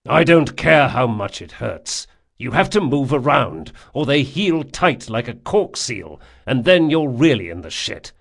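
loud, firm lecturing; stern insistent friend. Acted. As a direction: push him hard, no sympathy. Jean insists on exercise.

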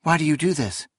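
a touch of sharpness; sharp. A narrator's reading. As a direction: ask it with an edge that slips out. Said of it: "her voice far sharper than she had wished".

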